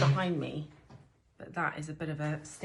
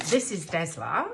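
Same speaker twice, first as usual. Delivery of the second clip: put-on light Australian accent on the name Desla, a touch louder; 2 semitones higher, 7 dB louder than usual. Gardener voicing an Australian friend's name, playful Australian accent. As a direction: say the name like an Aussie would. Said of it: "I have to say it in Australian accent".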